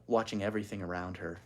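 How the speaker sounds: usual speaking voice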